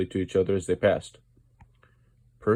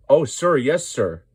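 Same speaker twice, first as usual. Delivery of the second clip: eager; 6 semitones higher, 7 dB louder, slower than usual. Acted. Eager engine begging to be chosen.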